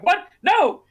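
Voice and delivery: high, loud cry of disbelief; hammed-up sudden panic; mock alarm. Quark looks over at the Dabo table, mock alarmed.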